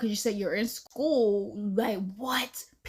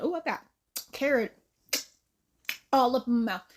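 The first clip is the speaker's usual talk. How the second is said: ordinary delivery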